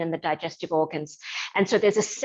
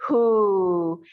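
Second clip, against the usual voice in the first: hoo a little lower; 4 dB louder than usual. Shown as a demonstration. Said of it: "a little lower".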